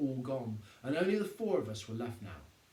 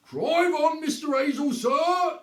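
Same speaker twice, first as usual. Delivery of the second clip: much higher and louder barked command; commanding policeman; 12 semitones higher, 12 dB louder, slower than usual. A narrator's reading. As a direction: order him off like a copper. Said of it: "his most commanding policeman's voice".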